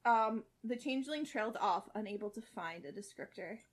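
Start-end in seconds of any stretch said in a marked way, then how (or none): none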